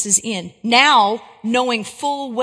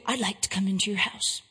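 hushed, menacing stranger sinking to a whisper; sinister intruder; 3 semitones lower, 7 dB quieter than usual. Acted. Hulking stranger at the door asks to come in, hushed and creeping. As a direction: lure them in softly, like a predator at the door. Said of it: "And he says to you".

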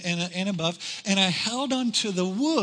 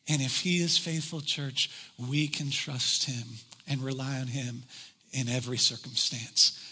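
hushed benediction tone; sermon close and prayer; 5 semitones lower than usual. Acted.

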